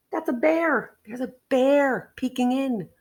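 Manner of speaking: ordinary delivery, nothing marked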